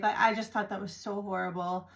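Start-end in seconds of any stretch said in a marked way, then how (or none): none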